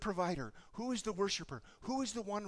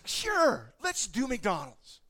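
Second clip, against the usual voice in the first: bright, higher, louder yes; a dad happily agreeing to McDonald's; eager dad; 5 semitones higher, 9 dB louder, much slower than usual. Retold or imitated. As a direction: cheerfully give in to the kid.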